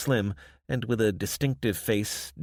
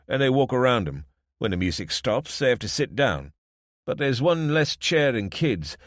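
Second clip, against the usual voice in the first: lightly voiced Desmond; 5 semitones higher, 5 dB louder than usual. Desmond explains musical chairs.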